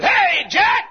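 high, loud greeting call; old acquaintance shouting a greeting after church. Retold or imitated. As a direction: hail an old friend across the room. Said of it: "a fellow walked up and said".

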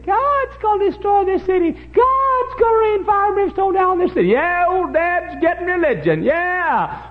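loud, very high jeering mimicry; mocking. Kids mocking old Dad's religion, jeering shout. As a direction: parrot the warning back in a high taunt. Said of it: "And they mocked".

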